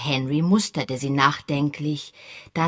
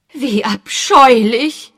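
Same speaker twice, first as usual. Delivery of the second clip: disgusted; 7 semitones higher, 11 dB louder, slower than usual. A narrator's reading. Mrs Bantry disgusted.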